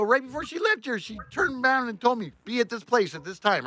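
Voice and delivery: high, agitated insistence; retold incredulous arguing; indignant protest. Acted argument with the boss.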